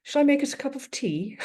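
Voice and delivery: hint of an English accent, calm and polite; dad's English accent. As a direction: imitate the dad's mild English accent offering tea. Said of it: "he's got this English accent and he says".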